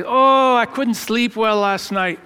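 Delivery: Scottish brogue. Retold or imitated. Head dishwasher retells young Scottish teammate dodging dishes, imitated Edinburgh brogue. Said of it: "that thick, you know, Edinburgh brogue".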